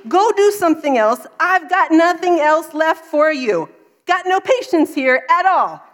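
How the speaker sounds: put-on high, loud, fed-up rant at a child; exasperated outburst. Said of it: "I turned to this sweet little boy, and I said".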